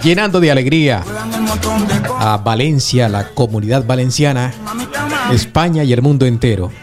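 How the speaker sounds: high, bright station promo read; radio announcer. Acted. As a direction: sell it like a radio jingle.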